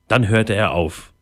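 usual speaking voice

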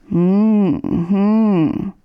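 drowsy hum hm, hm, half asleep; sleepy murmur. Acted. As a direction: mumble like someone still dreaming. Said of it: "murmelte Möndlein".

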